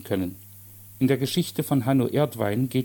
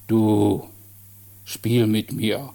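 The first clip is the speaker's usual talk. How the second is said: grollende Bassstimme; much slower than usual. A narrator's reading. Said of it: "kam es fordernd mit grollender Bassstimme".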